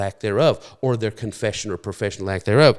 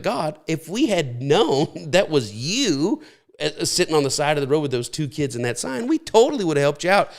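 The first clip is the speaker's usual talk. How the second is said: mock pleading excuse; 6 semitones higher than usual. The condemned excusing themselves before God, mock pleading.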